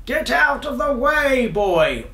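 high, loud commanding call; shouted royal order. A narrator's reading. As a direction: order him aside like an alarmed king. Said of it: "ordered the king".

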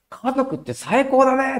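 put-on a grinning boy who has got his family back; cheerful boy. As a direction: beam out the hello like a happy kid. Boy happy about his family.

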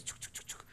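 soft unvoiced clicks; imitated clicking. The host mimics a clicking sound while explaining. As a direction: click it out under your breath.

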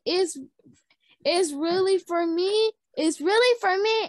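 can't believe it's for her; excited. Acted. Girl zooming around with the invitation, overjoyed.

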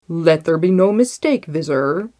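loud, commanding royal warning; stern threat. A narrator's reading. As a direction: lay down the law to a servant. Sultan threatens his vizier.

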